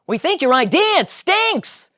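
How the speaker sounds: very high, loud Elaine; blunt Elaine. Acted. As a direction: tell him it stinks.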